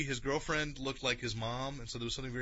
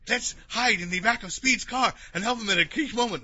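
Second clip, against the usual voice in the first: put-on excited kid voice; 9 semitones higher, 7 dB louder than usual. Retelling Robot Chicken sketch voice.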